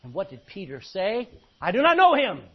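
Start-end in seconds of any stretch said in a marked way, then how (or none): 1.6-2.4 loud, high cry of denial; emphatic denial. Acted. Peter denying Jesus to his accusers, loud emphatic denial. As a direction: deny it forcefully, voice raised.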